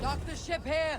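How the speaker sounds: high shouted order; calling out. Acted. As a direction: call it to the crew.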